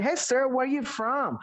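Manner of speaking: slow, lightly acted foreign question; mock English speaker. Teacher plays a foreigner asking where someone is from, slow. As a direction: ask it like a curious tourist. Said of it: "Kalau kita berbahasa Inggris".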